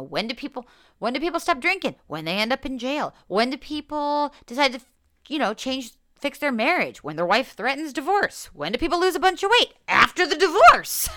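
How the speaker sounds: high-pitched, punchy retelling building to a loud punchline; animated rhetorical questions. Retold or imitated. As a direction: fire off questions and answers, rising to a shout. Retells animated questions she answered herself.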